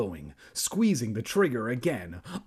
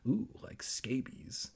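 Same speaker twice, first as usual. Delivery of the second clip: whisper; 9 dB quieter, slower than usual. Real, not acted. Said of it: "unvoiced aside".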